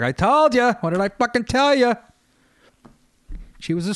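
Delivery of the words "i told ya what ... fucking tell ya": higher, louder triumphant I-told-you-so; gloating uncle. Retold or imitated. Uncle Al gloats to the family about the cigarettes, in a high, loud triumphant voice. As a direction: crow it like you were right all along. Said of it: "My Uncle Al's like".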